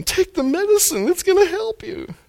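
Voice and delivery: high pleading parent voice; coaxing a child. Retold or imitated. Parent urging a child to take medicine, high coaxing. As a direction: coax a stubborn kid to take medicine, pitched high. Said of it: "It's like a kid with medicine".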